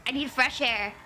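usual speaking voice

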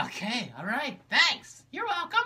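normal talking voice

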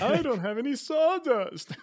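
high, loud, drawn-out mock wail; hammed-up helpless lament; mock complaint. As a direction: whine it like a silly, hammed-up tragedy. Mocking a woodworker's dumb problem.

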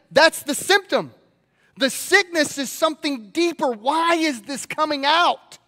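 ordinary talk, nothing marked

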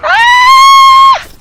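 very high, long, loud shriek; panicked scream. Real, not acted. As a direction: scream at the top of the range. Panic scream in game fight.

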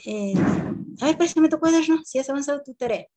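high, loud teacher demand; stern teacher. Retold or imitated. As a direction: call out the silent pupil sharply. Teacher calls out quiet pupil.

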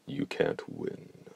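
very low, slow, cold voice; slow, deep and cold. Acted. Dee speaks, far deeper voice.

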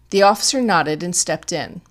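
ordinary delivery

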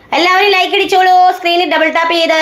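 high sing-song call to viewers. Real, not acted. Calls viewers to like the live. (ഉയർന്ന സ്വരത്തിൽ വിളിച്ചുപറയുന്നു)

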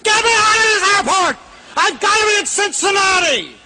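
very high, strained demanding yell; hammed-up high-pitched tantrum; mock screaming. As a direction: scream like an outraged stranded passenger. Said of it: "went around screaming at stewardesses".

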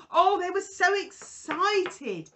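plain reading voice

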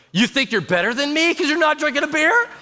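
high, affronted challenge; mock outrage. Drinker to pastor refusing a beer, mock outrage. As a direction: jump up in pitch, hammed-up accusation.

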